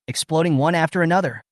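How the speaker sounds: ordinary delivery, nothing marked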